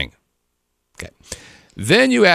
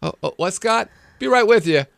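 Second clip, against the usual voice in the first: distracted mock chatter; 8 semitones higher than usual. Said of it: "She's like".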